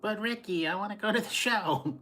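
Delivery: a hint of whiny Lucy begging; mock wheedling housewife; Lucy impression. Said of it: "Lucy and Ricky quote".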